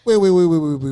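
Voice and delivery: loud, quick stacked waits; surprised interruption. Real, not acted. Host cutting off the story, loud surprised stop. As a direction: cut in, stunned.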